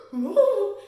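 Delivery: a high gasp, then a loud "No"; mock scandalized gasp. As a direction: play shocked gossiping girls. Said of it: "all the girls are like".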